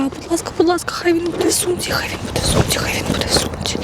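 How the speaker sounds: high plea fading into whisper; anxious pleading. Real, not acted. Vlogger hunts for a lost magnet in the bag, anxious pleading. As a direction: repeat it like a desperate little prayer, voice thinning to a whisper.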